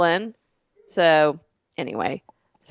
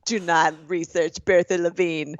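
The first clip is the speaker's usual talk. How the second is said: lightly put-on New York mafia warning; New York accent; 2 semitones lower than usual. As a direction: warn them off like a New York mobster. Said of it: "I get into my New York accent".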